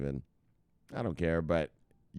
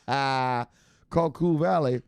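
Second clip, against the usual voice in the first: laughing; 6 semitones higher, 4 dB louder, much slower than usual. Real, not acted. Laughing at town name.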